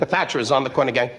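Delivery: ordinary delivery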